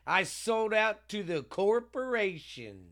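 mocking quote. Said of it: "should have said".

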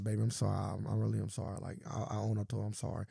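low, soft, soothing sorry; imitated apology. Retold lover apologizes to his baby, low soft voice. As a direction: apologize softly, like smoothing things over.

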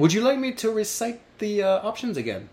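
lightly put-on British politeness; British accent. Host offering to repeat the list, lightly British-voiced. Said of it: "I just said that in a very British way".

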